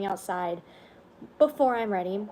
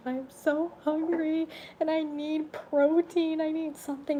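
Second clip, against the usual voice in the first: imitating crying; 8 semitones higher than usual. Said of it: "I was crying".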